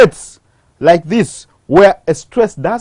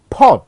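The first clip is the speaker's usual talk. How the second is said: "port" given full weight, pitched up; stressed second syllable; 4 semitones higher than usual. Shown as a demonstration. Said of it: "I have emphasized on the second syllable".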